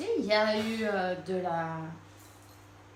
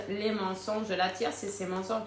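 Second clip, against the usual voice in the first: ordinary delivery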